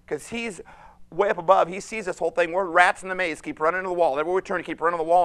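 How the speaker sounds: loud, driving sermon voice; fervent pulpit delivery; preaching at full force. Real, not acted. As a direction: preach it out to the congregation.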